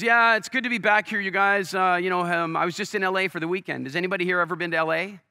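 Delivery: high, loud, over-friendly salesman schmooze; mocking the speaker who bragged to the AA crowd, in a loud, high, schmoozy salesman voice; pushy salesman impression. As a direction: ham up a too-chummy insurance salesman. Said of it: "the caricature of an American insurance salesman".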